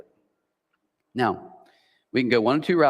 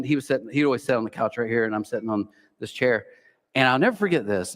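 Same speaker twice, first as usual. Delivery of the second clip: ordinary talk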